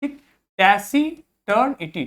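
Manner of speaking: टैसी टर्न इटी spoken as three separate parts; syllable by syllable. Shown as a demonstration. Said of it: "इसका pronunciation देखिए".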